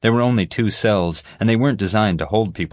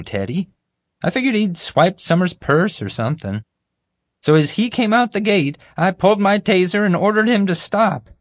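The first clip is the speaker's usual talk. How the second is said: high, eager, self-important voice; bumbling guard; 12 semitones higher, 3 dB louder than usual. Acted. Guard recounting the chase to the group, eagerly. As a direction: pitch it up and boast through the story.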